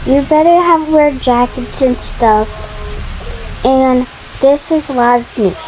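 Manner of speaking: put-on a child's lightly done newsreader; news report. Playing a TV newscaster warning of the storm, lightly done. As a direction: read it like live TV news.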